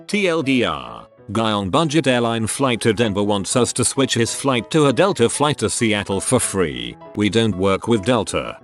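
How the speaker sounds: usual speaking voice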